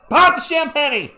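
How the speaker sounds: loud, high burst of play-by-play; excited call. Real, not acted. Fan calling the game live, sudden loud high excited call. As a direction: shout the pass like it's a big moment.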